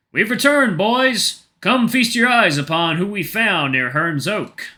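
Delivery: loud, high bellow across the camp; bellowing call. A narrator's reading. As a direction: bellow it out to the tents.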